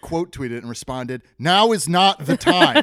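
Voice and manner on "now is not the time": loud, high, drawn-out refusal; indignant outburst. Acted. Host reading out own indignant tweet reply, loud outburst. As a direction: shout it slowly, pitched way up.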